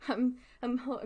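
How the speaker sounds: ordinary talk, nothing marked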